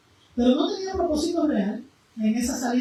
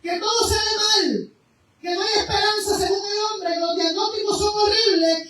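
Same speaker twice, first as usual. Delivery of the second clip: impassioned preaching; 6 semitones higher than usual. Acted. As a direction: preach it out with rising fervor. Preaching at full force. (predicación fervorosa)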